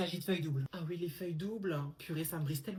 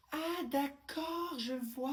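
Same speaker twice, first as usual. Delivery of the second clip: mock understanding; 6 semitones higher, much slower than usual. Principal mocks pupil's excuse.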